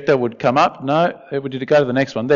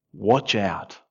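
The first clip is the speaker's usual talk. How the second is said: quiet warning; 8 semitones lower, 9 dB quieter than usual. Acted. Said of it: "he says in verse fifteen".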